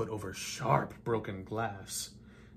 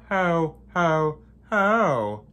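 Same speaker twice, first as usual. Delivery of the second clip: loud, higher put-on voice booming the hows; mock Santa laugh; 4 semitones higher, 9 dB louder, much slower than usual. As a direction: ham up a villain's Santa ho-ho. German villain's mock Santa laugh.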